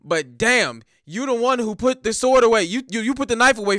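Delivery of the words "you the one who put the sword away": ordinary talk, nothing marked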